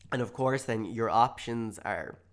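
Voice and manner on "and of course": ordinary delivery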